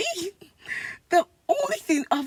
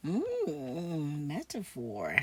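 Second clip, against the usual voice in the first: low, drawn-out sarcastic "metaphor"; mocking repeat; 9 semitones lower, 5 dB quieter, much slower than usual. Real, not acted. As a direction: repeat his word back with scorn, much lower. Mocking repeat of his word.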